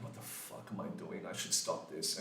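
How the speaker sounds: hushed, breathy self-talk; anxious thoughts whispered to yourself; whispered inner worry. Retold or imitated. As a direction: mutter the panic under your breath.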